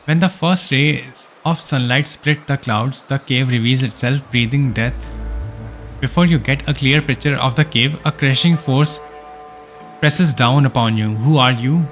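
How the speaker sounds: ordinary talk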